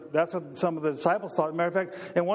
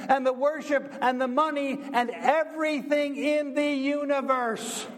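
rising, impassioned preacher's cadence; preaching; 5 semitones higher than usual. Acted. Pastor driving the point home to his congregation, impassioned. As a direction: build the crowd up line by line.

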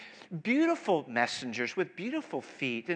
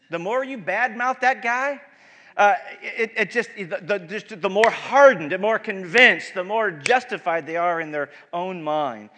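emphatic preaching; 3 semitones higher, 10 dB louder than usual. Acted.

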